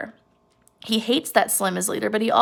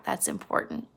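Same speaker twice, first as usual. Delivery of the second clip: whisper; 12 dB quieter than usual. Real, not acted. Teacher stresses a key point.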